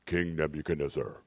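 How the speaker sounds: low, solemn voice from heaven; heavenly voice. Shown as a demonstration. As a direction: call his name like a voice from the sky. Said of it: "That's my heavenly voice".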